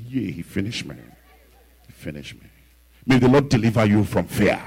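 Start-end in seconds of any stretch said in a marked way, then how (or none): 0.1-2.5 lower, softer, deflated voice; defeated murmur. Retold or imitated. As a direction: admit defeat quietly. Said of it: "The pastor said".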